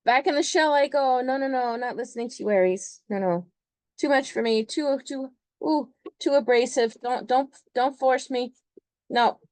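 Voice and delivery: high-pitched, overwhelmed refusing voice; hammed-up timid crab withdrawing; retreating Cancer moon. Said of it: "the moon Cancer's like".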